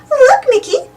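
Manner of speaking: Minnie calls to Mickey, a bright lift in her voice; excited. A narrator's reading. Minnie telling Mickey the Christmas countdown, excited. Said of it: "she said excitedly".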